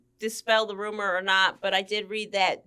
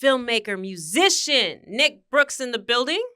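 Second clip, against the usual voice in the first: punchy, louder radio show welcome; radio host intro; 3 semitones higher, 3 dB louder than usual. Real, not acted. Host welcoming guest author to the show, radio host delivery. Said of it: "felt like a radio host".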